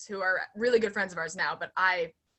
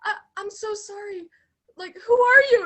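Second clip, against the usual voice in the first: confused; 13 semitones higher, 10 dB louder than usual. Retold or imitated. Confused reply to stranger.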